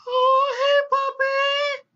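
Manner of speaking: put-on squealing high baby-talk at a dog; excited falsetto coo; cooing at a puppy. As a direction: gush over the puppy in a sky-high squeal.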